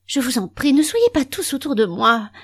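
moaning. Acted. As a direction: whine for everyone to back off. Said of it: "gémit Marguerite".

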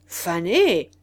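s'exclame plus fort, outré; répète le mot, scandalisé; exclamation indignée. Acted. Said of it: "s'exclama le marquis". (s'exclamer avec indignation)

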